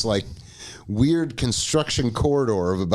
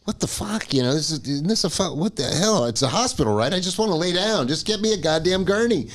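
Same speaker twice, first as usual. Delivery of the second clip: higher-pitched exasperated rant; irate; 6 semitones higher than usual. Retold or imitated. Dizzy patient demanding a gurney from hospital staff, irate. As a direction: complain indignantly, pitch climbing. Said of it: "I'm getting, like, a little irate".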